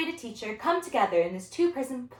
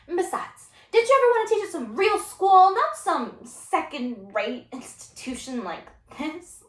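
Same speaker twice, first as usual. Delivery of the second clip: pushy student challenge, starting high and quick; entitled, argumentative student; unruly student; 7 semitones higher, 4 dB louder than usual. Acted. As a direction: needle the teacher, slowing on the insult.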